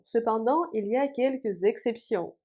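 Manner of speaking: normal talking voice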